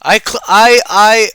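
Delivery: loud, high stammering confession; friend's loud stammer. Retold or imitated. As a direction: blurt it out, stumbling. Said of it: "was like".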